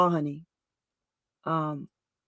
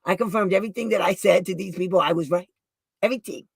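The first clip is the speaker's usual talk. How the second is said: normal talking voice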